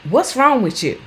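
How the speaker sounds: impatient mock "what's wrong with you"; snappy mock question. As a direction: snap it at them like a fed-up carer. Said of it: "don't say things like".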